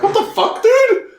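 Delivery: very high, startled what the fuck; squeaky disbelieving outburst; Bert shocked. Retold or imitated. Bert reacts to getting punched, high startled yelp. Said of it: "And Bert's like".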